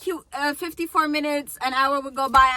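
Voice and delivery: ordinary delivery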